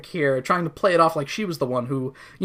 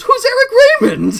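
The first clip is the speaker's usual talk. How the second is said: loud, high baffled cry; mock confusion; 10 dB louder than usual. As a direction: blurt it out high and loud, laughing. Voicing Taps, baffled.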